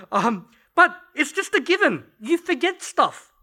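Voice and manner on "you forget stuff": ordinary talk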